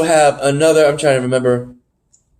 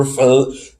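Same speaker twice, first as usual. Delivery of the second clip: normal talking voice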